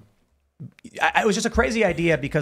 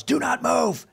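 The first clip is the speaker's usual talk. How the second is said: retold yell; 9 semitones higher, slower than usual. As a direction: imitate an instructor's urgent command. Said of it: "the instructor yells".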